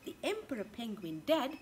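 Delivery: ordinary delivery, nothing marked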